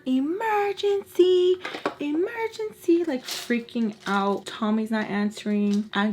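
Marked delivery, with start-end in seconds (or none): 0.0-3.0 panicked plea. Retold or imitated. Texting for help in panic.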